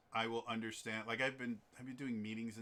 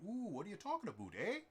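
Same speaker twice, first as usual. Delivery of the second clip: put-on high, quick caricatured Canadian accent; exaggerated rural Canadian accent, high-pitched; pronounced Canadian accent; 11 semitones higher, faster than usual. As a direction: do a hammed-up Canadian with a rising eh. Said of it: "really pronounced Canadian accents where they go".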